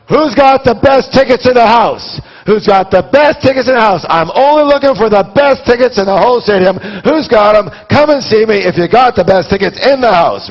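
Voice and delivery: high, loud hawker's cry across the crowd; yelling like a scalper. Retold or imitated. Outside the stadium, buying from scalpers: loud, high hawking shout. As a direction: bellow for the best tickets in the house. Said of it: "I actually do the yelling".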